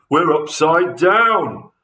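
high, loud, slow cry of alarm; shocked gasp. A narrator's reading. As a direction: blurt it out, horrified, stretching the words. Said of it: "gasped Mister Twit".

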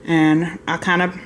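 ordinary delivery

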